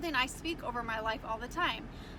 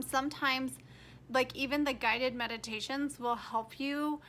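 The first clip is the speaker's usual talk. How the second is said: ordinary talk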